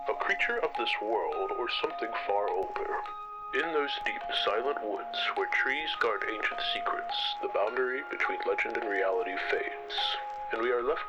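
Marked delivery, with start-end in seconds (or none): none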